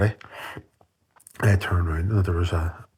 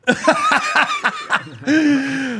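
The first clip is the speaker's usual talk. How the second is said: high, rolling laughter; long loud laugh; 4 dB louder than usual. Real, not acted. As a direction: laugh it all out.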